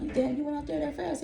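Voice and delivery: high-pitched incredulous question; retold surprise. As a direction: ask it high, in retold disbelief. Mock surprised question to partner.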